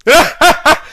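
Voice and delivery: high, loud evil laugh; villain laugh. Acted. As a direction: cackle like a villain. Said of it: "Laughed the man".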